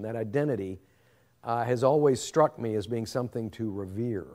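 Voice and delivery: ordinary delivery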